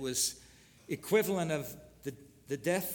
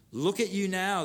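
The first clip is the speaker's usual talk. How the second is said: raised, louder taunt; a read-aloud jeer, higher and louder; mock yell; 5 semitones higher, 5 dB louder than usual. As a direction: call it out like a jeering crowd. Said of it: "they yelled at him".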